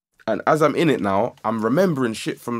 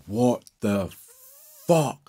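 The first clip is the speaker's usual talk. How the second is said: stunned disbelief; 2 semitones higher, slower than usual. Retold or imitated. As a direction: stretch it out in mock amazement. Acting out stunned realization.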